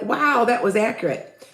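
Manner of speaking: normal talking voice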